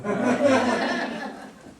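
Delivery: loud, high burst of laughter; real laugh. Real, not acted. Critic laughing after acting out the proposal, loud and high. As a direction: laugh out loud at your own joke.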